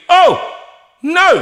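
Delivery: loud, dismayed cry; alarmed outcry. Retold or imitated. Singer watching the conductor fall mid-performance, alarmed. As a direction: cry out in mock horror.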